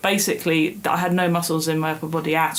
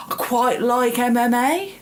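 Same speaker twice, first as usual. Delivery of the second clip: put-on northern accent; 6 semitones higher than usual. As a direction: do a hammed-up northern English accent. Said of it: "most appropriate accent for that sentence".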